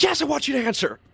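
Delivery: high, strained mock yell; acted angry outburst; retold yelling. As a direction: imitate an exasperated officer yelling a reply. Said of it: "yelling at him".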